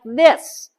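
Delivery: high, nasal demo of a voice up in the nose. Shown as a demonstration. Teacher demonstrating a nasal speaking voice for students. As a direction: show a voice stuck in the nose. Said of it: "speaking voices that sound like".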